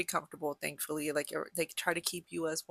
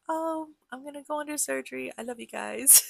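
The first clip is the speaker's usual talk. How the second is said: choked-up goodbye to family, pitched higher; tearful goodbye; 7 semitones higher than usual. Real, not acted. Retelling her pre-surgery call to family, tearfully. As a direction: say it through real tears. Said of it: "I don't know why I'm crying".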